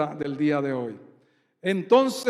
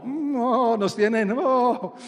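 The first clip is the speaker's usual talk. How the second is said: higher-pitched fed-up groaning; mock exasperation; 4 semitones higher, slower than usual. Preacher acting the fed-up disciples, mock exasperated groans. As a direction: groan like someone at the end of their patience. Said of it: "estos hombres estaban ya como".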